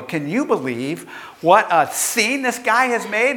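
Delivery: indignant wife. Retold or imitated. Wife telling people about the scene he made, indignant. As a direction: imitate a wife complaining to anyone who'll listen.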